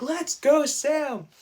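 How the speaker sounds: high-pitched, loud rallying shout; cheering. Retold or imitated. Viewer rooting for Sam mid-fight, high loud cheer. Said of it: "I was cheering for Sam".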